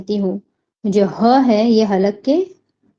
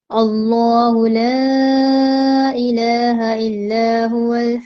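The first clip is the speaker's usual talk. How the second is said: slow, drawn-out tajweed chant; Quranic recitation; much slower than usual. Shown as a demonstration. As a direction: recite it slowly, stretching each vowel. Recites Ayat al-Kursi.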